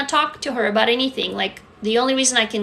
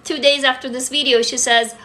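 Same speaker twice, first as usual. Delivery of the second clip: normal talking voice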